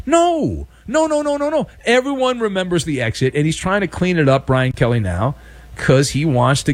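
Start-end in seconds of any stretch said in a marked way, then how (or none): 0.1-1.7 high, exasperated string of no; high-pitched acted rejection; mock refusal. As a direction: shoot the offer down in a put-on high voice.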